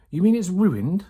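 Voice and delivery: a cook's shocked cry; dismayed surprise. A narrator's reading. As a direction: sound crestfallen at the news. Mabel dismayed about ruined tail.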